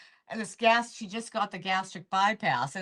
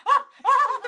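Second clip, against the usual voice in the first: high, loud laughing wail; crying with laughter; 8 dB louder than usual. Retold or imitated. Mother and daughter both cracking up at dinner, laugh-crying wail. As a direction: imitate helpless cry-laughing. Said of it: "like, crying".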